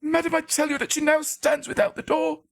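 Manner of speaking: high, strained shriek. Acted. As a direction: tear the words out in terror. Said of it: "shrieked out his syllables".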